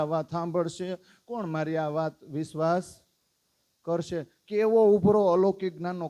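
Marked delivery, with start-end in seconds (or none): 4.5-6.1 louder burst of wonder; excited outburst. Acted. Devotee marvelling at his overflowing knowledge, louder with wonder. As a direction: let the overflow of joy spill out.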